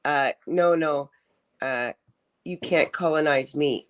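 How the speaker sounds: ordinary delivery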